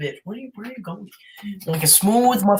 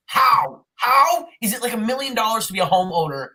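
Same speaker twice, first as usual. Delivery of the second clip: exasperated rant; 6 semitones higher than usual. Real, not acted. Ranting about house prices.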